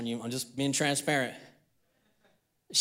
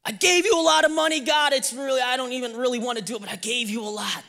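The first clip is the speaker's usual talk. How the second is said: mock grudging giver; 6 semitones higher, faster than usual. Giver reminds God about the money, mock reluctant. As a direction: grumble to God in a rush, hammed-up.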